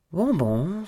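sighed reluctance. A narrator's reading. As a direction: give in as if it were a chore. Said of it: "soupira-t-elle en ajustant sa position avec l'air de s'imposer". (soupir de fausse résignation)

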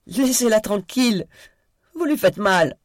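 put-on high, loud child's voice, fear and rage; a little boy screaming to protect his friend; frightened child's shout. A narrator's reading. Tom yells at Daisy to stop hurting Sophie, high child's cry. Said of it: "d'une voix tremblante d'enfant que la peur et la rage rendaient encore plus aiguë".

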